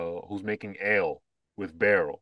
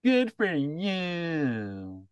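high, very drawn-out smug congratulation; smug, arrogant; 7 semitones higher, much slower than usual. Retold or imitated. Imitating smug electric car owners from South Park, drawn-out smugness. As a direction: stretch it out slowly in a high, self-satisfied parody voice. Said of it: "getting all smug and arrogant".

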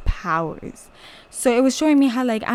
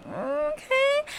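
much higher, bright rising okay; delighted okay; 4 dB quieter than usual. Retold or imitated. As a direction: squeal it with pleasure. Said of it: "I was like".

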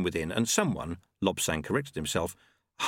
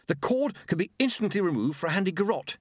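higher, keen inventor's patter; 9 semitones higher than usual. Acted. Monk demonstrating the drum's hidden cord, keen. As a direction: proudly demonstrate as the gadget monk.

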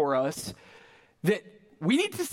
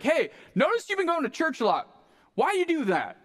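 higher-pitched, lightly acted friendly question; curious neighbor; 10 semitones higher than usual. Next door neighbor asking about church, curious. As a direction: ask it like an interested neighbor.

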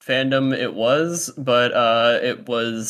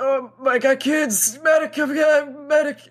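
panicked under fire. Retold or imitated. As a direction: scream for help like you're hit. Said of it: "He just starts screaming".

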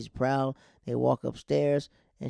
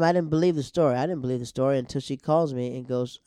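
ordinary talk

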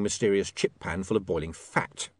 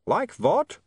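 put-on high, raised vampire accent; calling over noise; 12 semitones higher, 3 dB louder than usual. Otto questions William over the barking, raised voice. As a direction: ask loudly over a racket.

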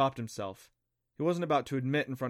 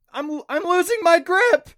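high, loud panicked screech; girl dangling over lava, screeching in terror; terrified screech; 13 dB louder than usual. Acted. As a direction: scream it as you slip. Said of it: "Clancy screeched".

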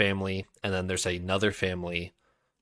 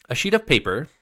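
amused jester, pitched up and louder; 6 semitones higher, 6 dB louder than usual. Acted. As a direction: tease her with a bright lift. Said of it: "the amused voice came".

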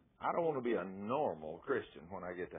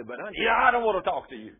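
loud, higher mock snap; mock loud retort; 9 semitones higher, 13 dB louder than usual. Husband snaps at his wife, loud mock retort. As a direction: bark it back at her. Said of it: "You back up and say".

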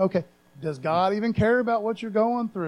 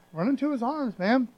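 ordinary talk, nothing marked

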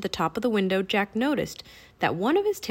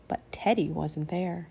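ordinary delivery, nothing marked

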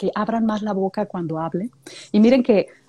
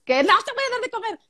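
much higher, fast sing-song; high sing-song scolding; 13 semitones higher, faster than usual. Retold or imitated. Owner refusing the cat more food, high and quick sing-song. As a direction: tease the cat in a squeaky sweet voice. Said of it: "Y dices".

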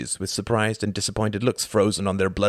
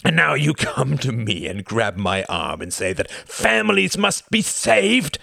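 louder, outraged question; raised voice; 6 semitones higher, 4 dB louder than usual. Acted. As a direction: throw the accusation back. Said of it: "The Count's voice rose".

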